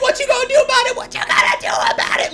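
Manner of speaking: very high, hammed-up squawking taunt; ridiculous egging-on voice. Storyteller re-enacting egging on the customers, ridiculous high voice. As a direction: goad them in a silly, screechy high voice. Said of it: "this, like, ridiculous voice".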